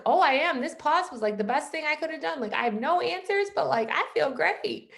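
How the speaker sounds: high, bubbly acted enthusiasm; gushing happiness. Happy coach telling her mentor about the pause, gushing.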